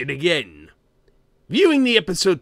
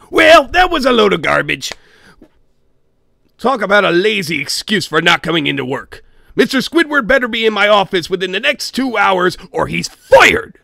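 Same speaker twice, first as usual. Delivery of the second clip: loud, fast, fed-up rant; angry boss; 3 semitones higher, 6 dB louder, faster than usual. Acted. Boss fuming over Squidward skipping work, loud rant. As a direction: bark it like a cheap boss who's had enough.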